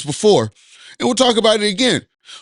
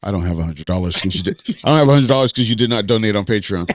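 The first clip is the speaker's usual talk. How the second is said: much lower, put-on deep voice; mock lender reply; 12 semitones lower than usual. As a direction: drop into a deep mock voice as the broke friend. Answers as the lender friend.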